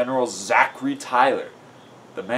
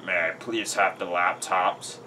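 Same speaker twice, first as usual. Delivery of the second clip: slow, lower-pitched polite request; lightly voiced general, softer and measured; 5 semitones lower, 6 dB quieter than usual. A narrator's reading. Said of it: "He told another soldier".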